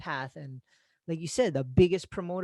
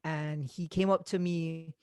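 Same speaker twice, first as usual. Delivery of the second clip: ordinary talk, nothing marked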